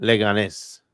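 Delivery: Leganés as le-ga-NES, stress on the last syllable; proper Spanish pronunciation. Shown as a demonstration. Reading out the phonetic pronunciation, careful Spanish. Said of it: "looking up the phonetic pronunciation".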